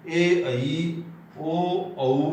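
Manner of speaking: ऐ as a-ee, औ as a-oo, two sounds each; Odia vowel names. Shown as a demonstration. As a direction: glide ऐ and औ into clear diphthongs. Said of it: "Odia में क्या बोलते हैं". (ऐ को अइ, औ को अउ बोलें)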